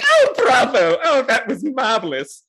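high, laughing, delighted host; gushing game master. Acted. Game master praising the players, high and laughing. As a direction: gush with praise through laughter. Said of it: "the clapping form of the game master".

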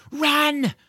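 high loud shout to run; urgent shout. Acted. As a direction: yell the warning to flee. George flees the film crew.

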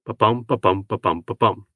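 Ba-bum, ba-bum falling, then ba-bum, ba-bum rising in answer; iambic beat in two halves. Shown as a demonstration. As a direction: chant the beat as call and response. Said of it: "sounds like two dimeters".

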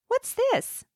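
put-on high little girl's voice, curious; child voice. Granddaughter asks grandmother about the cloth, high child voice. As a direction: play the granddaughter, small and high.

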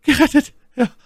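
high, loud cries of relief; elated Milady. A narrator's reading. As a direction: exclaim in rapture, pitched far up. Milady exclaims at being rescued. (jubelnder, hoher Ausruf)

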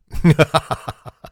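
loud burst of laughter; hearty laugh. Real, not acted. Laughs at own joke setup.

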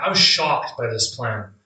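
normal talking voice

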